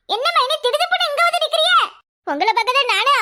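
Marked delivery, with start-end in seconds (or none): 0.1-1.8 surprised greeting pitched well up; a high voice, caught off guard by a visitor; high character voice. Acted. Woman greets her sister-in-law who turned up suddenly, in a high surprised voice.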